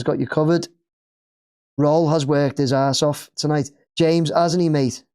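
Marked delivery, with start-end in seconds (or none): none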